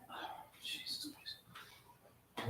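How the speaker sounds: slow, breathless whispered curse under the breath; exasperated whisper. Real, not acted. Streamer at his failing cameras, slow exasperated whisper.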